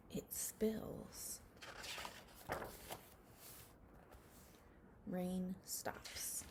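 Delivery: soft whisper as the rain ends; whispered reading. Acted. Teacher reading to class, whispering as the rain stops. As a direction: let the words fade into a whisper.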